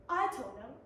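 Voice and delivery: usual speaking voice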